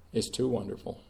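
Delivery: usual speaking voice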